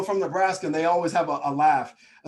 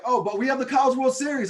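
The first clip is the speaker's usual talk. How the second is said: high, loud hopeful plug; eager boast; 10 semitones higher, 6 dB louder than usual. Retold or imitated. Midwesterner selling Omaha to skeptics, eager boast. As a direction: play up an over-eager local's boast.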